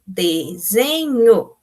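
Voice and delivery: "Desenho" drawn out slowly as de-se-nho; syllable by syllable. Shown as a demonstration. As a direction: split "Desenho" into three long, separate syllables. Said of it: "separou a sílaba".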